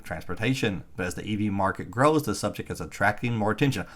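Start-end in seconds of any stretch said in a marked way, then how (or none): none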